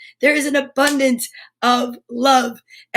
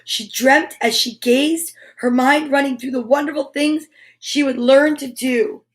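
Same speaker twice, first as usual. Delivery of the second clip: put-on reading to children. Reading picture book to kids.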